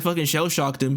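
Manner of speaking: ordinary talk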